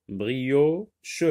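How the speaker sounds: "Brioche" as brio, pause, che; split into syllables. Shown as a demonstration. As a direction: lift brio, then drop a separate che in "Brioche". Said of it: "voici les mots séparés".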